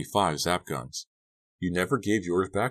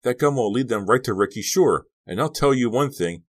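loud rant climbing higher; heated shouting; 5 semitones higher, 5 dB louder than usual. A narrator's reading. Boy fearing police will blame him, shouting heatedly. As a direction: build to an angry, high-pitched shout. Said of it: "shouting in Suki's face".

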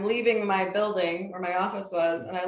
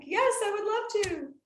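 bright, eager acceptance pitched much higher; eager yes; 13 semitones higher than usual. Retold or imitated. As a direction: leap at the invitation with a high, excited yes. Accepting a lunch invitation, retold.

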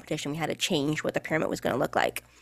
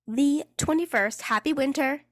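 sarcastic cheer; 7 semitones higher than usual. Real, not acted. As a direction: wish it with fake love. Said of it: "I say that with a lot of love in my eyes".

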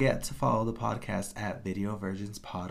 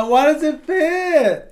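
high loud laughing question; 12 dB louder, slower than usual. Real, not acted. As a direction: laugh through the words. Laughing at the lyric.

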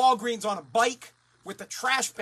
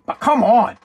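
sudden loud outburst; real exasperated yell; exasperated shout; 13 dB louder than usual. Real, not acted. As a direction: blurt it out at full volume. Exasperated outburst after a pause.

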